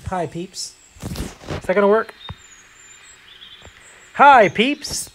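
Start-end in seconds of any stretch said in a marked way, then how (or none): 0.1-0.7 soft greeting. Real, not acted. Greeting players, tries again. 4.1-5.1 loud and high hello to the crowd; loud greeting call. Real, not acted. Greeting players, tries again.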